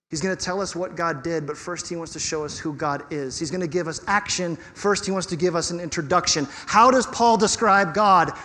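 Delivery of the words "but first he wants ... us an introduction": normal talking voice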